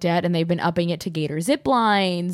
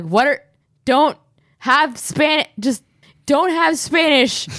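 flustered; 7 semitones higher, slower than usual. Retold or imitated. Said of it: "they were just kind of like".

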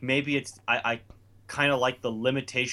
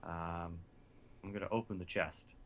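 hesitant mumble; 8 semitones lower, 14 dB quieter than usual. Retold or imitated. Retelling his own unsure reply.

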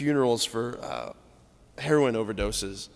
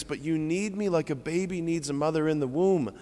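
usual speaking voice

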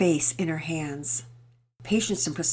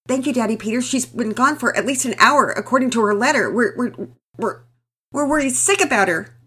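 louder, stammering, choked with worry; anguished father; 7 semitones higher, 9 dB louder than usual. Acted. Desperate father thanking neighbour, anguished stammering voice. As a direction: stumble over the words from fear. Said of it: "Mister Thompson was in anguish".

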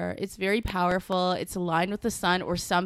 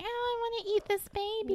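put-on gushing baby lover; 13 semitones higher, 6 dB quieter than usual. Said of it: "people always like".

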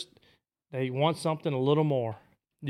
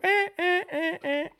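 very high duck quacks; fine hen call; slower than usual. Shown as a demonstration. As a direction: squeak like a hen mallard. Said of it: "when I go".

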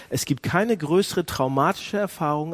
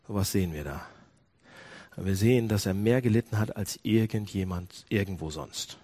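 usual speaking voice

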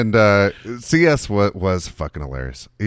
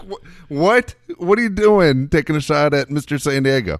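disbelief; 8 semitones higher, 4 dB louder than usual. Retold or imitated. Retold group disbelief.

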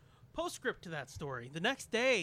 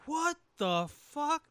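baffled; 9 semitones higher, 6 dB louder, slower than usual. Retold or imitated. Retold own reaction, baffled.

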